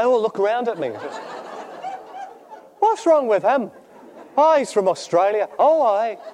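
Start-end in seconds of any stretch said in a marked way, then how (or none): 2.8-6.2 wedding guests muttering about the laughing Australian, mock Irish. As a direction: ham up the puzzled locals with an Irish lilt. Guests voiced reacting at wedding.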